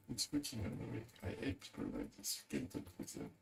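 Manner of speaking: normal talking voice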